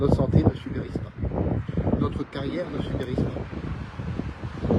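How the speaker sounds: shouting over wind. Real, not acted. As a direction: shout to be heard outdoors. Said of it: "j'espère que tu m'entends toujours malgré le vent".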